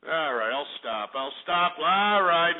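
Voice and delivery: usual speaking voice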